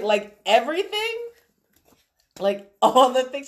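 loud, high disbelief; incredulous clarifying. Retold or imitated. Mom checking daughter means everything in the house, loud disbelief. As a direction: push it up and out, astonished.

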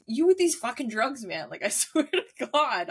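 high, exasperated retold rant; mock angry. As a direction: imitate a fed-up scolding, amused underneath. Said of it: "he got so mad at me".

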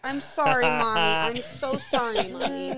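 put-on high, loud little kid pleading apology; sorry little boy. Son apologizing to mom after cursing, high pleading kid voice. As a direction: beg forgiveness like a caught four-year-old. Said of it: "He goes".

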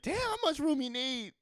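the chair grumbles at the sitter; cartoon chair voice; talking seat. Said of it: "Just like".

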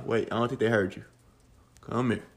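usual speaking voice

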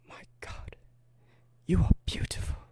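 hushed, breathy mock wooing; awestruck whisper. Young suitor to a beautiful stranger, awestruck whisper. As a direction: whisper it as a play-acted swoon.

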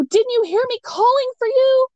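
high-pitched retold reproach; exasperated. Wife to husband who didn't hear her yelling, exasperated.

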